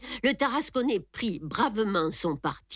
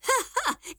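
gros rire aigu de Barbassou; éclat de rire. A narrator's reading. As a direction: part d'un grand rire moqueur. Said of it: "et riant de plus belle". (rire aigu et bruyant)

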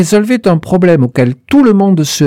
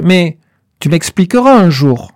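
ordinary delivery

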